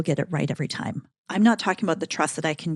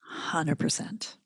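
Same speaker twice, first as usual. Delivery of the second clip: whisper; 3 semitones lower, 10 dB quieter, much slower than usual. Real, not acted. Whispered agreement.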